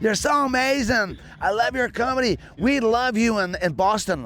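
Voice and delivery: mock American fan accent. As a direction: imitate the starstruck American from Boston. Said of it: "this American dude came up to me".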